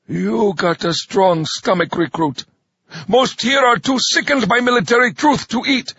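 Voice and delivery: drill sergeant. Acted. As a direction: bark it at the recruits. Drill sergeant addressing recruits.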